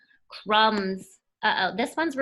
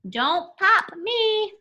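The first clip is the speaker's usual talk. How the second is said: drawn-out nervous little plea; a hammed-up balloon begging; slow, higher mock plea; 8 semitones higher, 4 dB louder, much slower than usual. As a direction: beg slowly, a bit higher, like a balloon. Balloon character pleading.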